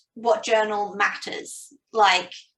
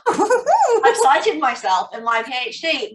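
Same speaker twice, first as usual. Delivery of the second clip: gleeful inner voice; 4 semitones higher, 6 dB louder, slower than usual. Retold or imitated. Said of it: "And I'm like".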